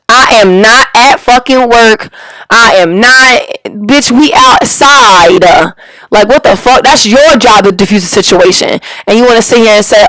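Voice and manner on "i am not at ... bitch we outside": slow, word-by-word emphasis; indignant insistence. Real, not acted. Woman insisting she is not at work, slow and emphatic. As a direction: draw each word out to hammer the point.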